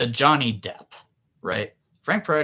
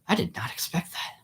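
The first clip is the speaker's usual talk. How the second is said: stunned whisper; 6 semitones higher, 4 dB quieter than usual. Retold or imitated. Stunned after the author passes.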